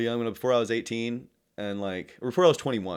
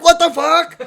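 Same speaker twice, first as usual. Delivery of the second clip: imitated high, loud outburst of disbelief; retold yell, amused and incredulous; mock astonished shout; 14 dB louder, slower than usual. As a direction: holler it as the startled other guy, laughing. Retells singer's startled reaction.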